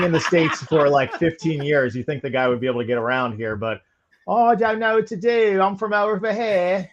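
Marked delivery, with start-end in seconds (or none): 4.3-6.9 playful Australian accent impression of a teammate; mock Australian accent. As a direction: play the helpless friend in an Australian accent. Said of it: "That's such a good accent".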